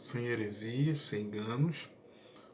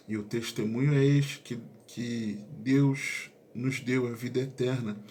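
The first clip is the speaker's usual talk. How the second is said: louder Bible reading voice; scripture reading; 4 dB louder than usual. Acted. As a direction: proclaim the verses with force. Reads long scripture passage aloud.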